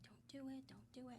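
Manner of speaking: soft whisper to herself. Retold or imitated. Hushed self-warning near the crying baby. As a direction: breathe it out, barely audible. Telling herself not to pick him up.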